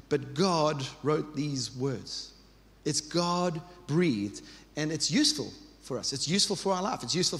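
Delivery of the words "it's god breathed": God-breathed drawn out slowly, each syllable weighed; slow emphatic. Real, not acted. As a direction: pastor to congregation, stretching out God-breathed slowly. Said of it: "slow emphatic repetition".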